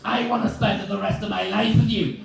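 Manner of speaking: high, loud declaration of love; imitating a frightening, loving wife; scary loving wife. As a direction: make affection sound like a threat. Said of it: "being loving with that voice".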